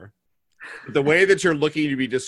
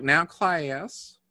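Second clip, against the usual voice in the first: North Carolina teacher drawl, pitched up; sing-out Southern schoolteacher accent; 6 semitones higher than usual. Retold or imitated. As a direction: imitate a Southern teacher addressing the class. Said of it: "class has two syllables".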